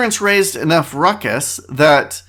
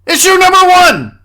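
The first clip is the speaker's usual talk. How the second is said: high, loud segment-title shout; shouted announcement; 13 semitones higher, 7 dB louder than usual. Pretend. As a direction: belt it out like a headline.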